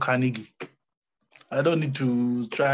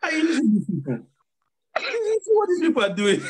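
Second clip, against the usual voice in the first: laughing disbelief; 13 semitones higher, 4 dB louder than usual. Real, not acted. Laughing at the people.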